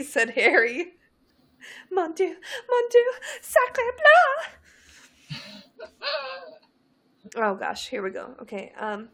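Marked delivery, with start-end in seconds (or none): 1.9-5.9 high, hammed-up French exclamation; French accent. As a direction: squeal it in a fake French accent. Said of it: "what she was saying sounded sexy".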